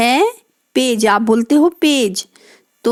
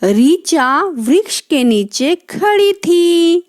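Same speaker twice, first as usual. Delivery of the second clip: slow, higher sentence reading for a class; reading aloud to children; 5 semitones higher, slower than usual. Shown as a demonstration. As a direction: read the line out clearly and slowly. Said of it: "reading example sentence". (बच्चों को धीमे, ऊँचे स्वर में वाक्य पढ़ना)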